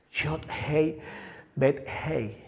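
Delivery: "Yod, Hei, Bet, Hei" said softly like breathing; breath-like Hebrew. Shown as a demonstration. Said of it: "es como el sonido del respirar". ("Yod, Hei, Bet, Hei" como un respiro)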